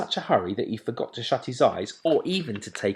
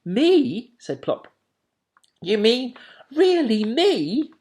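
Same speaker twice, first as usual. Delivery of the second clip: high, thrilled disbelief; can't believe his luck; delighted owlet; 8 dB louder, slower than usual. A narrator's reading. Plop delighted.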